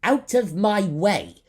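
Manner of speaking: loud, high, slow commanding shout. A narrator's reading. Captain commanding the creature to move, loud. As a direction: order him aside with full authority. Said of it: "in his most commanding tone".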